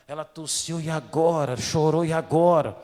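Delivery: loud, alarmed cries of a worried parent; panicked parent. Retold or imitated. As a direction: blurt it out like a frightened new mother. Anxious parent voiced.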